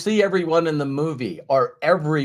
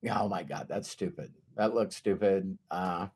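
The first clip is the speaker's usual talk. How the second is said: low, very quiet grumble to yourself; acted low, soft aside of doubt; muttered self-doubt; 8 semitones lower, 10 dB quieter than usual. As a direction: mutter it under your breath, embarrassed. Acting self-conscious doubts while breathing.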